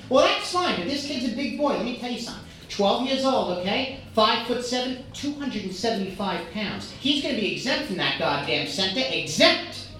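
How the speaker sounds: Queens mom bragging. Retold or imitated. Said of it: "this lady from Queens".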